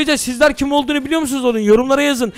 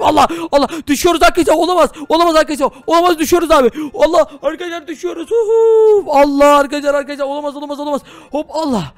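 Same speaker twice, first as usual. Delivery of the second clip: fast, high panicked cries; yelling as the house drops; panicked falling; 5 semitones higher, faster than usual. Acted. (düşerken panikle bağıran baba)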